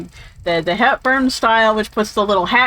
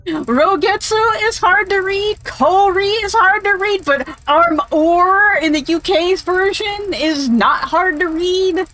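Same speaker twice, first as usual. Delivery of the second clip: higher-pitched, drawn-out mock complaint; sarcastic mockery; 6 semitones higher, slower than usual. Real, not acted. Arguing French spellings are no easier, sarcastic mockery. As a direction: hammer the absurd double standard, pitched up.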